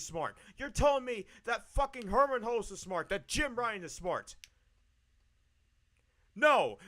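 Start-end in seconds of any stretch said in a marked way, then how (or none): none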